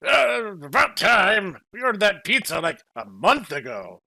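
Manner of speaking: hungry villager grumbling at the pizza courier; impatient customer. Acted. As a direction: complain like you've waited forever. Customer voiced in the story.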